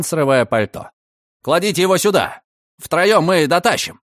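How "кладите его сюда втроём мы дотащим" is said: urgent command. A narrator's reading. Urgent rescue orders.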